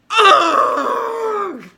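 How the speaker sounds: high, loud cry of disgust; exasperated yelp; disgusted groan. Acted. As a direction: let out a shrill ugh. Dismay at ruined eggs.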